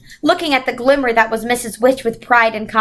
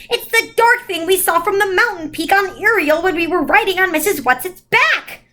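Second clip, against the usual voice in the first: put-on urgent child voice; 8 semitones higher than usual. Child's frightened outburst.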